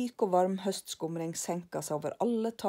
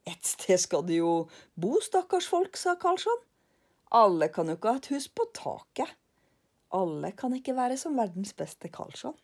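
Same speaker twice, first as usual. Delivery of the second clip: higher, louder self-satisfied remark; smug Karlsson; 3 semitones higher, 4 dB louder than usual. Acted. Karlsson tells lillebror nobody matches him, higher. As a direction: boast about himself.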